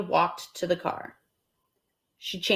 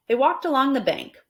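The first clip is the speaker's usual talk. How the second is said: ordinary delivery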